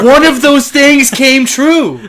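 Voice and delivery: high, drawn-out comic outburst; loud indignant protest. Real, not acted. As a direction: protest it loudly, stretching every word. Complains about broken promises, laughing scene.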